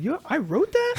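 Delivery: can't believe he wrote it; rereading old posts, stunned at his own words; amazed disbelief. Retold or imitated. Surprised at his own writing.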